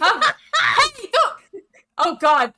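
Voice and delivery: high, loud, hammed-up alarm; panicked shouting. Boat crew heading for the waterfall, panicked shouting. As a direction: yell the order in a comic panic.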